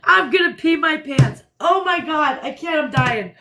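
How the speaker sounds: ordinary talk, nothing marked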